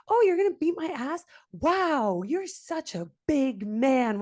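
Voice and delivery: high, drawn-out sarcastic awe; mocking taunt. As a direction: goad him with exaggerated fake amazement. Retold sarcastic reply to threat.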